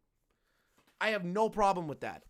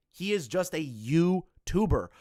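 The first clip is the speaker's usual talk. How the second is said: ordinary talk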